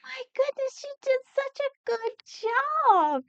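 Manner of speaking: high, excited praise; gushing praise. Acted. Grandma praises the child, high and gushing. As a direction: gush over the child's effort.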